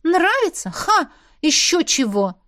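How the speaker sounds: high, loud scoffing laugh-off; indignant "Ha!" of denial; scornful denial. A narrator's reading. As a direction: scoff it away with arms crossed. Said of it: "ответила, скрестив на груди руки".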